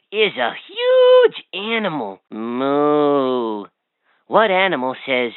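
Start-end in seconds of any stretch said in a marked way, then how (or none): 2.3-3.7 a low drawn-out moo; low cow moo. Acted. Toy quizzing the child, a low cow moo.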